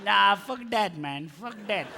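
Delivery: put-on awkward swearing in an Indian accent. Cab driver trying out swear words, Indian accent. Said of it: "got that accent".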